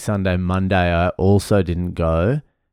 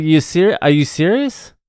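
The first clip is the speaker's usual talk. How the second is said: mock outrage, pitched higher, joy leaking through; rising disbelief covering delight; fake annoyance; 6 semitones higher than usual. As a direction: pretend to be annoyed but sound thrilled. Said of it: "trying to make it sound like I was annoyed at the union".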